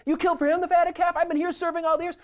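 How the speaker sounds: put-on older brother venting at his father, frustrated. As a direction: throw the complaint at your father. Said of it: "The older brother's really frustrated".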